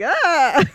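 loud, high blurted "ah"; panicked attempt at accent. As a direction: blurt a helpless loud noise instead of an accent. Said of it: "Somebody's, like, do an accent. I'm like".

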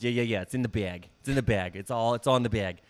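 lightly voiced New York salesman, cocky and sure; imitating a New York coworker, a touch of swagger; New York impersonation. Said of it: "I would do an impersonation of him".

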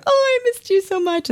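put-on very high, gushing kid voice; high-pitched mock reunion; imitating reunited kids. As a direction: squeal it like a child reuniting with a playmate.

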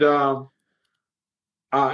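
ordinary talk, nothing marked

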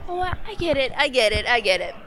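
put-on a sheepish old woman caving in; flustered old lady. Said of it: "the old lady was like".

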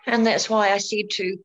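normal talking voice

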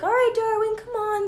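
high, loud sing-song coaxing a scared dog. Retold or imitated. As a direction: coax him across like a nervous puppy. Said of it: "We have to be like".

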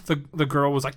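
ordinary delivery

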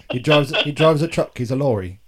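hammed-up English accent; mock English accent. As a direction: try on a Cockney voice. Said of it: "when I do accents".

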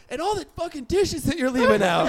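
high, loud comic tirade; mock angry outburst. Roommate barging in over dirty dishes, mock furious. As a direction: burst in hollering, hammed-up outrage.

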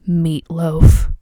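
loud, punched-out name; dramatic reveal. Retold or imitated. Teller reveals who the lost nephew is, loud dramatic reveal. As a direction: announce the name with mock drama.

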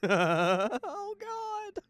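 very high-pitched strained squeal; real nervous yelp climbing way up; tense. Real, not acted. Horror viewer on edge beside a co-host, tense. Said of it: "why are you so tense".

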